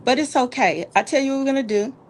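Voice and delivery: ordinary delivery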